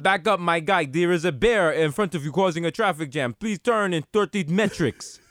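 put-on Russian-accented GPS navigator; mock Russian knockoff of a street guy; stolen-voice Russian accent. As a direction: give directions in a comic Russian accent. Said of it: "they have your accent".

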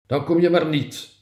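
high, loud, curt snap; snapped retort. A narrator's reading. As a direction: snap it back, offended. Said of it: "snauwde ze".

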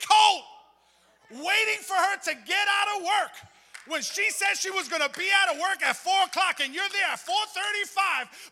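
put-on poking fun at men chasing girlfriends, high and loud; hammed-up lovesick guy. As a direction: ham up the eager boyfriend. Mock scene of waiting boyfriend.

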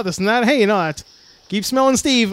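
usual speaking voice

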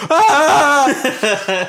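very high, loud laughing shriek; high shriek that breaks into laughter. Retold or imitated. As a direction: let out a squealing cry of confusion. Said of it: "And he's just like".

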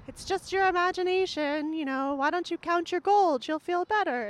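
high mock-wifely reassurance; wife calling back. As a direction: call back lightly to soothe your husband. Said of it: "calls back to the giant".

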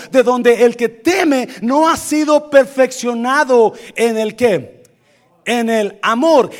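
loud, high, projected pulpit proclamation; full-force preaching. Real, not acted. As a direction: preach it out to the whole room.

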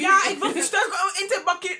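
ordinary talk, nothing marked

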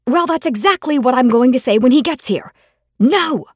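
high, loud defiant shout. Acted. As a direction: shout it back, rising to the no. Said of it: "I shouted".

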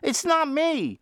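high, insistent mock denial; adamant denial. Vin insisting to a fan it isn't him, hammed-up. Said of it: "I would be becoming adamant about it".

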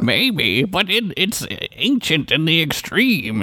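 lightly voiced lofty old author; a hint of grand Southern raconteur; mock Mark Twain. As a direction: savour the big words like a pompous writer. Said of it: "is what he says".

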